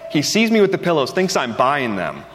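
ordinary delivery

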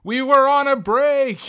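high, loud, hammed-up outburst; mock defensive yell. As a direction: holler the excuse in exaggerated protest. Acts out the dad's defensive excuse.

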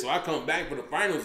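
usual speaking voice

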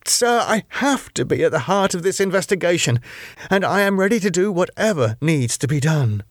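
pressing and eager, pitched high; urgent. Acted. Claire insists to her superintendent, urgent and high. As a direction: Claire pleads to be let in on the case. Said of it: "with the same strange urgency".

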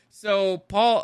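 usual speaking voice

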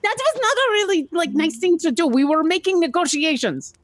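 high-pitched little creature voice; scolding creature character. Acted. Player voices her creature character.

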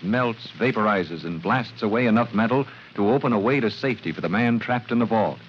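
usual speaking voice

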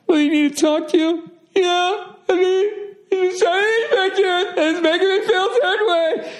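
very high, squeaky fake-tearful whine; mock crying woman. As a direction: imitate a woman breaking down in exaggerated tears. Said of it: "literally breaking down crying".

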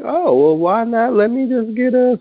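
high, sing-song temptation; high mock-innocent excuse; playful tempted voice. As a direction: play at giving in to a drink. Retold own tempted thought.

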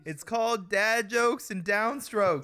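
high, slow mock plug for his podcast; imitating Joey. Imitating the absent friend plugging his podcast, high and slow. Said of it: "and he's like".

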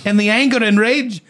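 high mock British drifting into Scottish; accidental Scottish. Garden owner describing her rage, accent sliding into Scottish. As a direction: let the accent slip into high-pitched Scottish. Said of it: "Now I'm going, I'm going Scottish".

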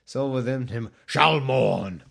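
mock dramatic ending; strong finish. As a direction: land the last line with gravity. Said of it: "just wanted to do a strong finish".

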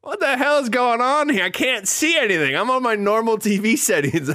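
high-pitched comic exasperation; worked-up mock complaint; exasperated viewer. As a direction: raise the pitch, baffled by a dark screen. Acted complaint about dark episode.

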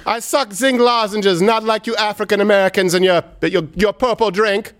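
slow, high put-on Jamaican accent; mock Jamaican accent. Told to say he sucks zinc lozenges, slow mock Jamaican accent. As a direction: drag it out in a shaky attempt at Jamaican accent. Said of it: "say it slowly in a Jamaican accent".

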